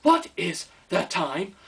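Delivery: tutorial voice. Acted. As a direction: talk like a patronising lesson recording. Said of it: "a tutorial's in my head".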